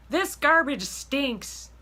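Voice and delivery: high, slow, loud pointed hint about "garbage"; mom's pointed remark. Retold or imitated. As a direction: drop a passive hint like mom. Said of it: "say these random statements like".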